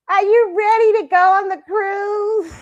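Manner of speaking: put-on high, slow, wavering old lady voice; grandma voice. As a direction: imitate an excited grandmother asking. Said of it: "my grandmother was like".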